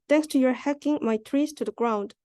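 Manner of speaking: usual speaking voice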